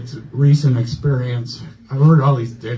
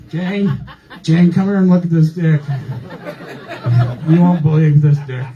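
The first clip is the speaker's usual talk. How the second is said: louder, higher mock-alarmed call; retold urgent calling out; 4 semitones higher, 4 dB louder than usual. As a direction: shout for the nurse as the disbelieving doctor. Retold doctor calls in the nurse.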